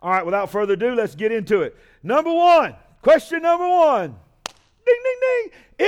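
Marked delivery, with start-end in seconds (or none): 2.0-4.1 high, loud announcing call; game show announcement to the congregation, high and loud; excited announcement. Real, not acted. Announcing first question. 4.9-5.9 high sung bell chime; bell imitation.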